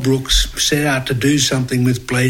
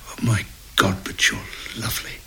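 soft, lower whisper to the mirror; whispered to himself; 4 semitones lower, 8 dB quieter, faster than usual. Retold or imitated. Actor to his mirror, a soft lower whisper. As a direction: breathe it to your reflection. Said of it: "he turns to the mirror and goes".

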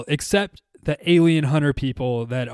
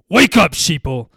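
paranoid late night rant; 3 semitones higher, 7 dB louder than usual. Pretend. Mocking conspiracy theorists.

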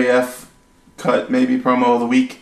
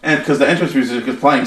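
usual speaking voice

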